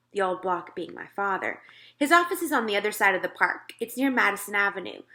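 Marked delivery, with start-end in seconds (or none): none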